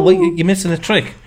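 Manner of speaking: ordinary delivery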